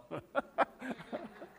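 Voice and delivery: brief high laugh at his own joke; real laugh. Real, not acted. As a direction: laugh at your own punchline.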